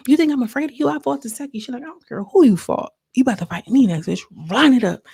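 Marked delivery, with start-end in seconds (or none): 0.1-1.6 imitated high-pitched challenge; mock fight talk, pitched up; challenging. Retold confrontation before a fight. 1.8-5.0 retold fighting talk, raised voice; confrontational. Retold comeback squaring up to fight.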